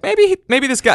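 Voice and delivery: high-voiced, eager maybe; hopeful fan reaction.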